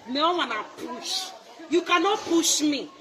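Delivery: ordinary talk, nothing marked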